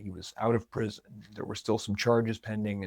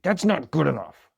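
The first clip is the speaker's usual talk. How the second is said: mock angry; 5 semitones higher, 4 dB louder than usual. Husband angry over sales figures.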